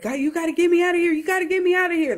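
high, very fast frantic plea; imitated panicked screaming. Freaked-out believer begging God for escape from hell, re-enacted screaming. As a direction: beg to escape, pitch way up and words rushing. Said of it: "Like, I'm, I'm screaming".

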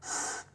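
"S" as a bare voiceless hiss; just the soft "sss" sound, not the letter's name. Shown as a demonstration. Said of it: "vậy nó phát âm thành".

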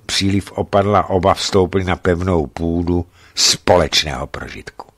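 plain reading voice

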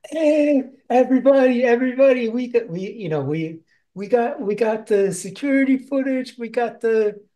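high, loud, eager announcing voice; excited Jala. Retold or imitated. Jala calling everybody over, high and eager. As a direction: burst in calling the whole crew over.

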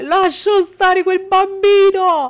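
"Lascio stare quel bambino" shouted high and slow; exasperated shout. Real, not acted. Gamer shouting "Lascio stare quel bambino" at the clingy baby, high, loud and slow. Repeated louder, slower.

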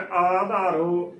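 ਆਧਾਰੋ starting on long "aa"; correct long-vowel reading. Shown as a demonstration. Teacher quoting the written word, ਆਧਾਰੋ with long first "aa". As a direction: hold the opening vowel of ਆਧਾਰੋ. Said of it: "ਪਰ ਗੁਰਬਾਣੀ ਦੇ ਵਿੱਚ".

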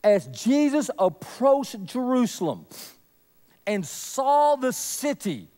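normal talking voice

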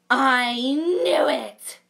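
loud, slow I knew it; triumphant cry. Acted. Dot thinking the wolf will eat her, loud and slow. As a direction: shout it as proof at last. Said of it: "cried Dot".

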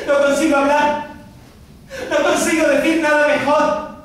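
breathless theatrical tirade of justifications; impassioned outpouring. Acted. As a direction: pile up the reasons in a high, insistent voice. Pours out reasons for having left.

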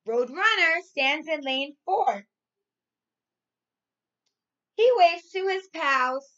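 put-on loud, lively storybook reading for young kids; reading to children. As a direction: read it out big and bright, like to a class of little ones. Teacher reads storybook to class.

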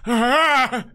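a sudden high mock cry of fright; mock startled yelp. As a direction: jump and yelp, half joking. Mock startled reaction to child.

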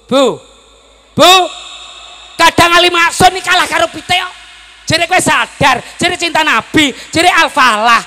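high, loud scolding sermon shout; impassioned shouted sermon; full-force preaching. Real, not acted.

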